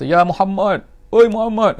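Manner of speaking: high, loud shout that keeps climbing; loud call. Retold or imitated. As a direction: yell his name across the camp. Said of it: "Dia cakap kuat". (badui memanggil Nabi dengan jeritan kuat)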